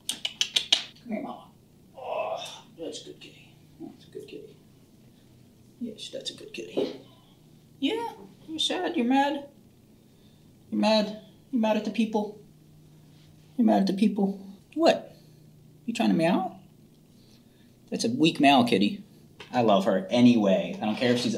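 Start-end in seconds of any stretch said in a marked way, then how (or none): 0.1-7.0 soft whisper, very high on the last kitty; whispered pet talk. Real, not acted. Whispering to the cat. 7.8-16.6 high sing-song pet voice. Real, not acted. Teasing talk to the cat.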